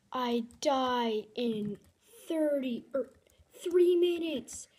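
slow, loud high-pitched disbelief; self-mocking wail of defeat; exasperated outburst. Real, not acted. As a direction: hammer each word out in frustration. Furious at own death in game.

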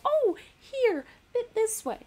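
high-pitched little self-direction; mock camera fiddling. Walking round the camera to adjust it, acted high-pitched.

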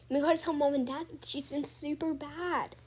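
ordinary talk, nothing marked

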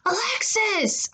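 high, drawn-out angry call; yelling for sister. A narrator's reading. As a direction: call her out from another room. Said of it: "I yelled".

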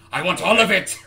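mock greedy shout. Player jokingly demands the whole world, shouting. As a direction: blurt it out with playful greed.

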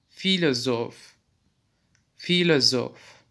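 filozof drawn out slowly with an f sound; slow demonstration. Shown as a demonstration. Teacher slowly showing German ph as f. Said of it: "F diye okunmaktadır".

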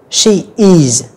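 SHE IS as two separate full words; careful "she is", each word clear; full form. Shown as a demonstration. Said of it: "chúng ta đọc đầy đủ".

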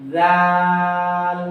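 জাল light and long, tongue on the front teeth; thin dhal, drawn out. Shown as a demonstration. Teacher demonstrating for students, thin long dhal. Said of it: "পাতলা করে উচ্চারণ করবেন".

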